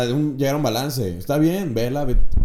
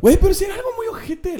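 much higher, shouted outrage; outraged shout; 3 dB louder, faster than usual. Real, not acted. Objecting to a mean mom, high outraged shout. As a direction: burst out in disbelief.

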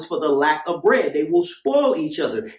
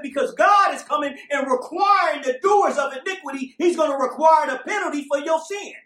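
high shouting preaching; doomsday street preacher; 10 semitones higher than usual. Acted. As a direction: shout the warning from the pulpit. Preaching at full force.

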